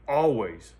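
Always punched out loud as a one-word answer; a loud, falling Always; emphatic always. Real, not acted. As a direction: hit Always hard and let it fall. Insisting foster mother backed him.

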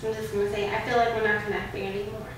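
soft, subdued fake heartache; feigned hurt. Girlfriend pranks boyfriend with a breakup, feigned hurt. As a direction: play hurt, keeping it small and quiet.

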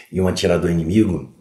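normal talking voice

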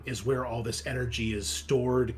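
ordinary talk, nothing marked

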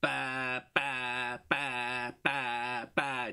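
evenly spaced identical "ba" pulses; steady beat imitation. Shown as a demonstration. Lecturer demonstrating boring one-beat-at-a-time music, evenly pulsed ba sounds. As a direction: chant ba on every beat, hard onsets. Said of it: "If your music really is".

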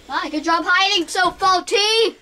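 loud, high cheer across the room; excited calling out. Real, not acted. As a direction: call out proudly to the hider. Calls out to found sister.

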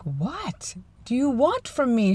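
high, slow, exasperated; drawn-out fed-up question. Retold or imitated. Exasperated reply to wife.

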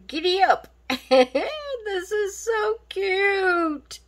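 high playful cowgirl cheer; playful giddy up. Pretend. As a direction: whoop it like a cowgirl.

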